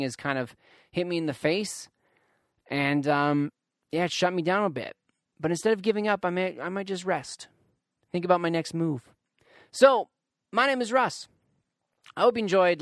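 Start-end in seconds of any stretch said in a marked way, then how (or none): none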